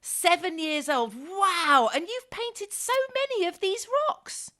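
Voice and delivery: high, slow, delighted wow; amazed. Real, not acted. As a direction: sound truly amazed at her age. Host amazed by seven-year-old.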